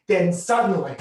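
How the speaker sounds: ordinary delivery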